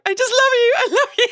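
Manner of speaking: a fan yelling love at Jimmy; starstruck fan. Retold or imitated. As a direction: gush at a celebrity, losing it. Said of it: "I was like".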